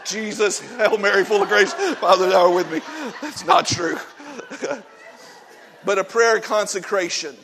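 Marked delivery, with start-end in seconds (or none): 0.0-2.8 shouting a jumbled panicked prayer; loud, over-the-top desperate praying; mock frantic prayer. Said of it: "this is because Eric prayed". 3.2-4.8 laughing while saying it; breaking into real laughter. Real, not acted. Laughing after his own joke.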